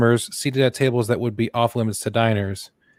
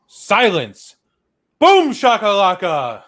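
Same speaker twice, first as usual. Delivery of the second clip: dramatic pronouncement; 8 semitones higher, 4 dB louder, much slower than usual. Retold or imitated. Absurd legal response read out.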